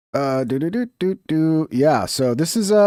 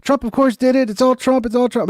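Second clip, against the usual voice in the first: high, sneering mock voice of the critics; whiny mock chant, pitched high; mocking quote; 9 semitones higher than usual. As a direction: parody the gloating accusers up high. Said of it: "just, uh, claim".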